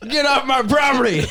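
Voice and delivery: high angry yell at an intruder; shouting at trespasser. Pretend. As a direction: order a prowler off your land. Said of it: "yell at 'em".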